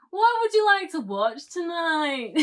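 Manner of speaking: high, loud caricature of a Scot; exaggerated Scottish accent. Wife parroting husband's question, exaggerated Scottish accent. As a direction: swoop through it in hammed-up Scottish. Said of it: "it's in a very exaggerated accent".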